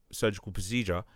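normal talking voice

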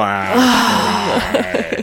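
imitating the mom's face and tone; mock mom. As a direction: tease her catchphrase. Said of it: "you're all".

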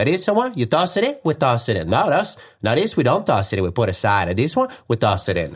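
put-on Italian accent swooping high on the questions. Husband deciding what gets packed for his wife, Italian accent. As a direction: play an Italian game-show host judging each item. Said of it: "It was an Italian game show".

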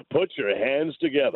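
slow, drawn-out club announcer; mock club DJ working the room; strip club DJ voice. Asked if he practiced it, slow strip club DJ voice. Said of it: "being a strip club D J voice guy".

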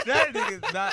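high loud laugh into words. Real, not acted. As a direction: burst out laughing then protest. Laughing at friend's claim.

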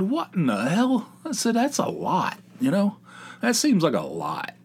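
high, indignant disbelief at the price; mock outrage. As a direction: squeal it like the bill just landed. Shock at 1800 dollar quote.

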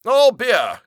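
high, loud cry; cried out. Acted. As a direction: burst out with it. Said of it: "cried Turkey".

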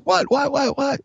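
high rapid what what what; imitating mom. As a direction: play a startled mom calling from the kitchen. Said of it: "Meany's in the kitchen going".

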